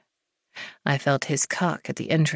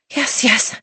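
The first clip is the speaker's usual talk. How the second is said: higher, pleading; breathy eager yes; 7 semitones higher than usual. A narrator's reading. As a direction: urge him on. Heroine pleading, voiced.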